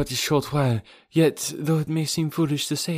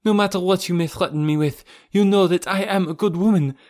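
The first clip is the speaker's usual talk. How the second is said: higher, louder, defiant woman's voice; defiant Olga; 7 semitones higher, 3 dB louder than usual. Acted. Olga warns Rokoff she will tell Raoul, defiant. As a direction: rise to a threat at the end.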